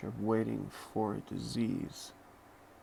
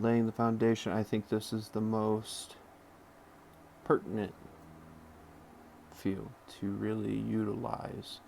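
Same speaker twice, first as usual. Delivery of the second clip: usual speaking voice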